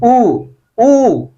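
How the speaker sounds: drawn-out ऊ, ऊ; stretched ऊ vowel; long ऊ. Shown as a demonstration. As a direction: hold ऊ for a long time. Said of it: "ज्यादा समय लग रहा है".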